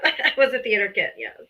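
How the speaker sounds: ordinary talk, nothing marked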